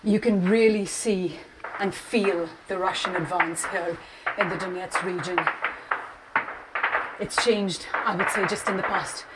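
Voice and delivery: breathless hushed field report; urgent report from the front line; war correspondent. Real, not acted. Live field report after running.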